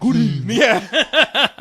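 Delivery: usual speaking voice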